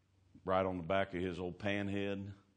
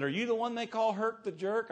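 high, loud woman's voice; mock high-pitched female questioner; imitated church woman; 5 dB louder than usual. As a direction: ask boldly in a put-on lady's pitch. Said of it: "she goes, she walks up to him and says".

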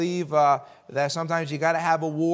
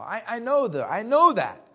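higher, insistent acknowledgement; head off the objection, rising to a louder finish; emphatic concession; 5 semitones higher, 5 dB louder than usual. Real, not acted. Preacher conceding Joab's motive to listeners, insistent.

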